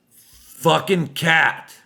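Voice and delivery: sudden furious yell, dragged out; yelling at the cat. Real, not acted. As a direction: bellow at the cat scratching the door. Yells at cat scratching door.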